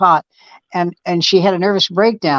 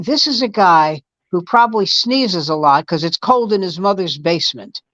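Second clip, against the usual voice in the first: ordinary delivery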